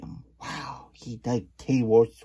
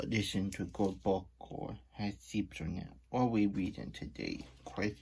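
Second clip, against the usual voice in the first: usual speaking voice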